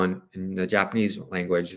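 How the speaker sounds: ordinary delivery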